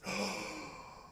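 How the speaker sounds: sudden audible intake of breath; sharp gasp. Shown as a demonstration. Teacher shows students what negative charge feels like, sharp gasp. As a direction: gasp as if protecting yourself.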